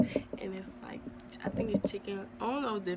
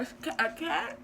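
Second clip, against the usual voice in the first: shocked; 7 semitones higher, 6 dB louder than usual. Real, not acted. Shocked at killing a cat.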